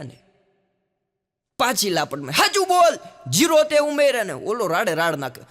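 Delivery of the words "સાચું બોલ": high, loud furious yell; angry father. Retold or imitated. Father slaps son again, furious high yell. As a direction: demand the truth at the top of your voice.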